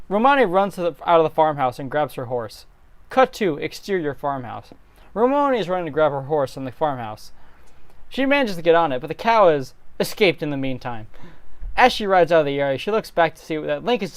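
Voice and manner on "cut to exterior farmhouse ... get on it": ordinary talk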